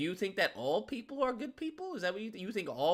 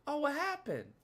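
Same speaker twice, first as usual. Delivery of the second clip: high, soft cooing pity; mock sympathy; 7 semitones higher, 5 dB quieter than usual. Onlooker asking the heartbroken what happened, mock sympathy. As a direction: coo with exaggerated concern.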